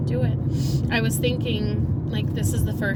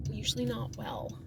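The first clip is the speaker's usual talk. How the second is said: breathy whisper; 12 dB quieter than usual. Real, not acted. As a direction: drop to a whisper on it. Whispered aside.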